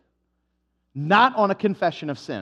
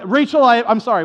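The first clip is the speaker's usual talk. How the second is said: louder, higher blurted sorry; grudging apology; 4 semitones higher, 7 dB louder than usual. Acted. Stubborn person grudgingly apologizing to Rachel, loud. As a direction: force the word out. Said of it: "They're like".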